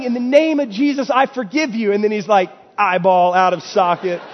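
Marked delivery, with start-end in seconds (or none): 0.0-1.9 higher, louder mock battle cry; imitating a yell. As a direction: shout it out like a desperate prayer. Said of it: "And so he's yelling".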